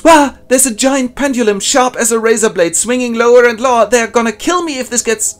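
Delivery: high, loud hammed-up cartoon panic; mock panic. Prisoner spotting the swinging pendulum, high loud mock panic. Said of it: "Then he looks up and goes".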